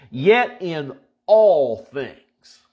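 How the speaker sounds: all drawn out slow and heavy; stress on all. Shown as a demonstration. Preacher stressing all to the congregation, slow and heavy. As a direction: lean hard on the word all, slowly. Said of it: "underline the word all".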